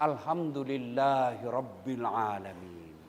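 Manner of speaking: slow, low, deliberate reciting; proper slow recitation. Shown as a demonstration. Preacher demonstrates proper thanks, slow low recitation. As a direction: say each syllable of "Alhamdulillahirabbil alamin" slowly and clearly. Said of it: "Jangan kau bilang".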